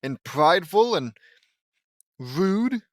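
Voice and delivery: exaggerated goody-goody impersonation; mock good Lynn. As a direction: plead innocence in a hammed-up character voice. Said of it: "good Lynn's gonna be all like".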